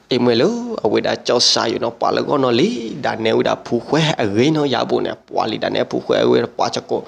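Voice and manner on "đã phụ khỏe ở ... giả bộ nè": usual speaking voice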